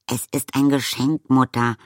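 high, loud, exasperated; annoyed daughter's protest; indignant. A narrator's reading. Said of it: "erklärte Arrietty unwillig".

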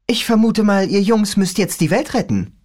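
put-on a young girl griping about her father; teen girl voice. Rachel teasing.

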